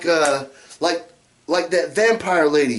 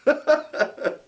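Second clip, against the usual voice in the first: gleeful; 9 semitones higher, slower than usual. Real, not acted. Relishing the irony.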